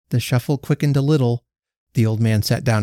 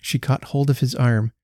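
usual speaking voice

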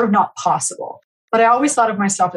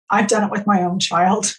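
usual speaking voice

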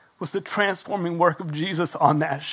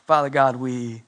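closing prayer; 2 semitones lower than usual. Acted.

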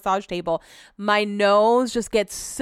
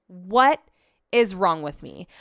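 ordinary talk, nothing marked

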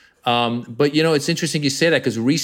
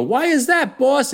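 put-on much higher, wide-eyed street kid; eager kid; 13 semitones higher than usual. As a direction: ask the boss like a curious kid. Kids answering the mob boss.

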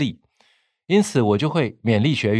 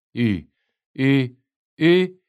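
pitched up a little, bright and projected; public presentation voice; 2 semitones higher than usual. Shown as a demonstration. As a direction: raise the pitch two steps for an audience. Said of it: "这叫做公开简报的声音". (略微提高音调的简报声音)

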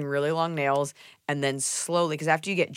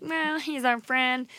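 dismissive friends; 8 semitones higher, slower than usual. Retold or imitated.

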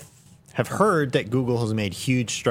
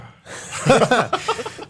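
high burst of laughter; 5 dB louder than usual. Real, not acted. Reacts to German accent idea.

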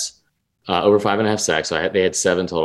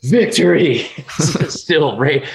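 laughing triumph; 7 semitones higher, slower than usual. Real, not acted.

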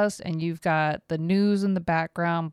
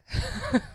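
high squeal laugh. Real, not acted. Laughing after outburst.

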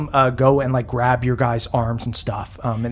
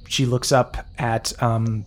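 usual speaking voice

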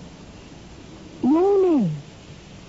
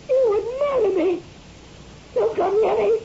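higher, frightened warning; terrified warning; 9 semitones higher than usual. Acted. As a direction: keep him off in terror. Wife warns husband away.

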